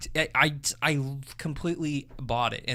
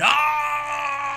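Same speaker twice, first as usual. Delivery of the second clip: high shouted ah of triumph; excited yell; mock cheer; 13 semitones higher, 5 dB louder than usual. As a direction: belt it like a fan cheering. Cheering at the movie moment.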